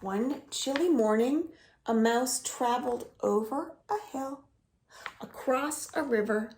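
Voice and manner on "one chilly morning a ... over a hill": usual speaking voice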